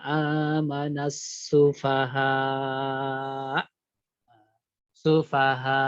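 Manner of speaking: long drawn "sufaha" stopped hard on the hamzah; pressed final hamzah. Shown as a demonstration. Quran teacher shows students the pressed hamzah in "sufaha", firmly stopped. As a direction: chant "sufaha" long, then cut it with a firm glottal stop. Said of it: "Bacanya ditekan pada hamzahnya".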